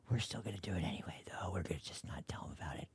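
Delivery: hushed, fast scheming whisper as if hiding it; mock conspiratorial whisper. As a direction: whisper it like a sneaky politician sharing a secret. Mocking politicians' secret aside.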